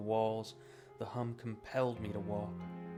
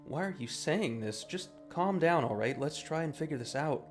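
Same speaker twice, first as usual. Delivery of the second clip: urgent calming; 6 semitones higher, 8 dB louder than usual. Acted. Trying to calm the double.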